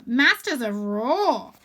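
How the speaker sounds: slow sneering drawl on "Masters of Raw"; sarcastic. Acted. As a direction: curl the lip on it. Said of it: "with a sarcastic curl of the lip".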